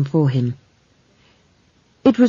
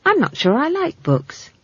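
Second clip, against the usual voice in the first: conversational; 10 semitones higher than usual. Acted. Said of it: "said Esk conversationally".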